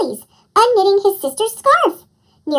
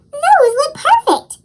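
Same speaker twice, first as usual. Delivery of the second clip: puzzled; 9 semitones higher, 3 dB louder, slower than usual. A narrator's reading. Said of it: "he said, puzzled".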